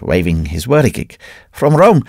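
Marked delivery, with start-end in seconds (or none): 1.5-2.0 high, louder repeated cry; shouting priest. A narrator's reading. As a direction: hammer the words again as a mad prophet. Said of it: "shouted the priest".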